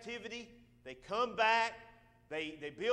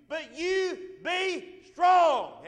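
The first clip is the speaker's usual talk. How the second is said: slow, loud, high shout of strong; exhorting shout at the church; preaching shout; 8 semitones higher, 11 dB louder, much slower than usual. Real, not acted.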